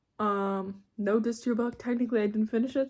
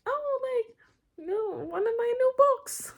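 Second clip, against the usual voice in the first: mock quote; 13 semitones higher, slower than usual. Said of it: "and I was like".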